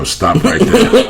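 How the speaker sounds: high, slowed, exaggerated stop; mock stern cutoff. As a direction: halt her with a drawn-out mock command. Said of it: "And I go".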